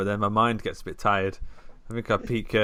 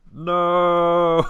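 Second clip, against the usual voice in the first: long, high, loud cry; film-line cry; 8 semitones higher, 8 dB louder than usual. Acted. Contestant guessing the film line, drawn-out cry. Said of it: "I think the line is just".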